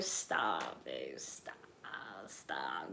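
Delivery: shy, embarrassed plea to stop teasing; bashful hush. Real, not acted. Embarrassed by being teased.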